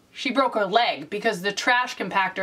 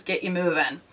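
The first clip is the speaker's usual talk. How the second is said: normal talking voice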